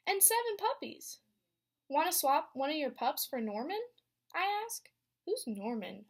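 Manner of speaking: lively read-aloud storytelling voice; story narration. A narrator's reading. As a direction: read it to a class of young kids. Teacher reads picture book aloud.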